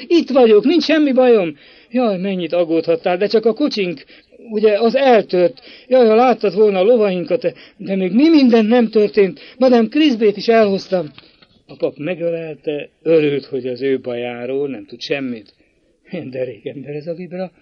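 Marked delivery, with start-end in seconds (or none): 0.1-11.1 high and loud, bursting with cheer; joyful call. A narrator's reading. Girl running to her brother, calling out joyfully. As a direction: gush happily, far higher and louder. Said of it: "vidám kiáltással".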